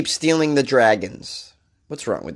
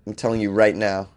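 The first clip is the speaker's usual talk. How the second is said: usual speaking voice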